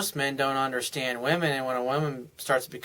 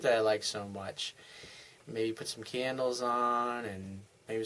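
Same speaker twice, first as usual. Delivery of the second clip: seductive murmur; 8 dB quieter than usual. Retold or imitated. Husband softly tempting his wife upstairs, seductive and soft. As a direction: draw her in, softly and suggestively.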